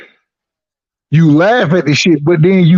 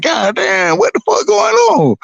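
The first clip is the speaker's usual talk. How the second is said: mock stunned reaction; 9 semitones higher than usual.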